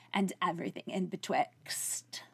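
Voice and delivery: hushed breathy aside; whispering. Acted. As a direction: drop to a whisper.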